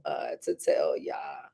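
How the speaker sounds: low, breathless whisper to the audience; a real whispered aside while writing. Real, not acted. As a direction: drop to a whisper as if jotting a note.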